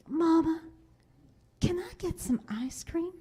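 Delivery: imitating pleading child. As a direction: coax Mama like a child after treats. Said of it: "And she goes".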